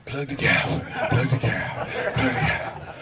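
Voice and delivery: low, soft breathy muttering; hushed rookie chant; nervous self-reminder. Retold or imitated. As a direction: mutter it under your breath, scared. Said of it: "I'm looking at him like".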